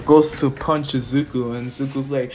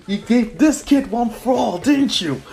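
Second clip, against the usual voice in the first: high, needling acted-out accusation; hammed-up villain goading; villain's taunt; 7 semitones higher than usual. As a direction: taunt your rival, pitch leaping on key words. Acted villain taunting All Might.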